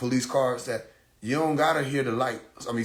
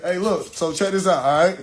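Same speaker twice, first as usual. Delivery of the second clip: raised, loud fast-talker pitch; loud, higher, pushy appeal; insistent pleading; 5 semitones higher, 6 dB louder than usual. Retold or imitated. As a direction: talk up at the officer from the back seat. Acting himself arguing from back seat.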